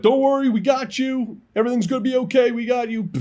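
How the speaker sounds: chirpy, loud American reassurance; American aircrew. Retold or imitated. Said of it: "the Americans were like".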